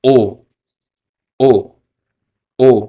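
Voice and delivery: ओ as a pure Spanish "o"; Spanish letter name. Shown as a demonstration. Teacher to students, careful Spanish vowel. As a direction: repeat ओ clearly. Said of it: "ओ को कैसे pronounce करते हैं".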